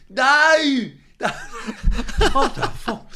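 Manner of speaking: loud, high mock Brummie scream; Brummie accent. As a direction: yell in panic with a Midlands accent. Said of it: "he's very brummy, he was, very kind of Midlands accent".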